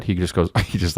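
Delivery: ordinary talk, nothing marked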